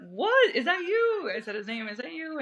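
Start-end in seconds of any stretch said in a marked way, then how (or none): 0.1-1.3 high, loud cry of disbelief; surprised. Retold or imitated. Shocked reunion with an old friend. 1.9-2.4 surprised. Retold or imitated. Repeating the question at the reunion.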